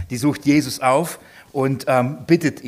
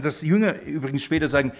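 ordinary talk, nothing marked

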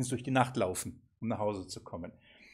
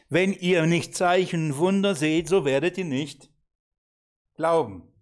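louder, higher scolding; 3 semitones higher, 5 dB louder than usual. Acted. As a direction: read it out as a stern reproach. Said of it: "Das ist eine Schelte übrigens".

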